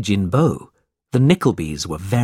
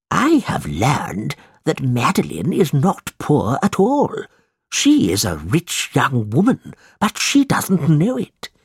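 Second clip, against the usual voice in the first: put-on slow, high, scheming old miser; old moneylender; 5 semitones higher, slower than usual. A narrator's reading. Mister Gride telling Ralph his secret, slow and sly. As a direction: savour the news like a greedy old man.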